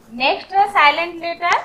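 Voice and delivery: normal talking voice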